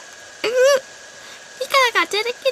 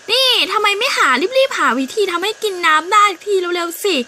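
impatient demand; 6 semitones higher, 5 dB louder than usual. Acted. Bird demands water impatiently.

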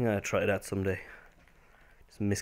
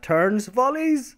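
commentator voice; 12 semitones higher, 5 dB louder, much slower than usual. Pretend. Mock commentary on game.

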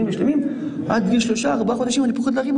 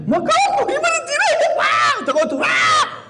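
mock horrified shout. Rabbi mocking outsiders who are horrified at the circumcision, shouting high. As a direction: shriek it like an outraged outsider.

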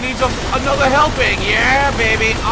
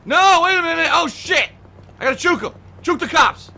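panicked; 8 semitones higher, 5 dB louder than usual. Real, not acted. Panicked police chase.

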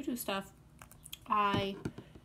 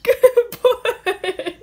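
talking to a pet; 10 semitones higher, 12 dB louder, much slower than usual. Real, not acted. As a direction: cheer the cat on, laughing. Coaxing pet, laughing.

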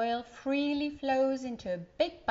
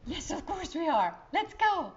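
put-on higher, louder eager child voice; excited child; 7 semitones higher, 6 dB louder than usual. Child eagerly answering her uncle, high excited kid voice. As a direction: bounce with a kid's excitement.

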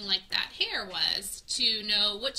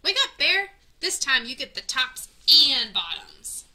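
much higher and louder triumphant call; 4 semitones higher, 8 dB louder than usual. A narrator's reading. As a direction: belt it out to wake Bear. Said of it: "Hare called out".

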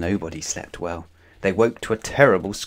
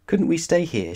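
higher hopeful plea; suggesting; 8 semitones higher than usual. A narrator's reading. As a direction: gently suggest it, voice raised. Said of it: "she suggested".

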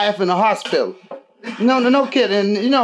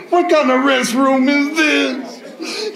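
put-on baffled drunk friend; 5 semitones higher, much slower than usual. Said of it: "He said".

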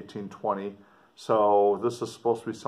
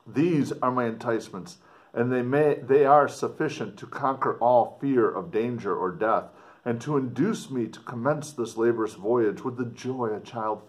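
raised, expressive letter reading; dramatic reading; 4 semitones higher, 5 dB louder than usual. Acted. Teacher reading the explorer's letter aloud to students, expressive. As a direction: read it out to the class with feeling.

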